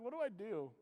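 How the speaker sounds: a soft, teary hint of a lost kid; quietly wobble out the question like a scared twelve-year-old; retold crying. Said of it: "sitting in the gravel road crying".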